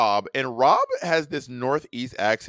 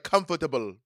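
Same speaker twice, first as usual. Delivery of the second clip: put-on Caribbean accent. Host imitating Caribbeans saying "Comfortable", Caribbean accent. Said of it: "the way that Caribbeans be like".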